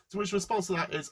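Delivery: ordinary delivery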